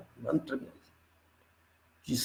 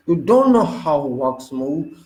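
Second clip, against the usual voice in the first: usual speaking voice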